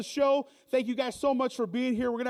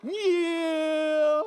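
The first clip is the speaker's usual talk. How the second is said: cheer; 5 semitones higher, 5 dB louder than usual. Acted. Cheer ending.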